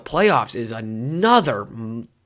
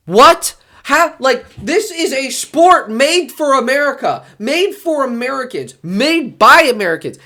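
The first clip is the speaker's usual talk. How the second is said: much higher, louder disbelief; can't believe we lost at handball; incredulous outrage; 9 semitones higher, 4 dB louder, slower than usual. Real, not acted. Outraged over US handball loss.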